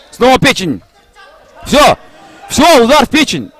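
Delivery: high excited knockout call. Real, not acted. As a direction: shout it as the fighter drops. Knockout called live.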